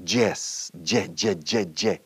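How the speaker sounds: "yes" as "jess", repeated "je, je"; imitated error, "jess, je, je, je"; mispronounced Y. Shown as a demonstration. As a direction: stutter the hard j of a learner's "yes". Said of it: "Porque la gente dice yes".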